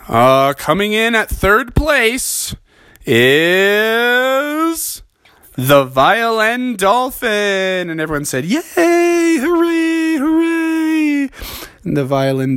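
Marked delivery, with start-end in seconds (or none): 0.1-7.9 slow, drawn-out announcer reading; lightly voiced announcer, building suspense; awards announcer. The announcer names third place, slow and drawn out. 8.4-11.3 high sing-song mock cheer; polite crowd cheer. Everyone cheers for the dolphin, high sing-song.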